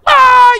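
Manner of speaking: high, loud, imitating wife's breakdown; mock wailing scream. Father imitating his wife falling apart, mock wailing scream. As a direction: shriek as the wife finally cracks. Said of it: "And now she's like".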